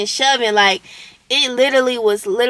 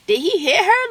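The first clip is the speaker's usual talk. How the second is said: alarmed; 8 semitones higher than usual. Retold or imitated. Overhearing fight, alarmed.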